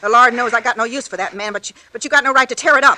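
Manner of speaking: angry scolding. Acted. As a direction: scold him hard and build. Mother furious over torn photo.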